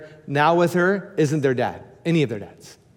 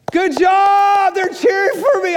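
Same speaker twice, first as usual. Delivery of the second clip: high loud cheering voice; cheering neighbors; 14 semitones higher, 5 dB louder than usual. Retold or imitated. Neighbors cheering the pastor mowing, high and loud. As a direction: cheer him on like a crowd.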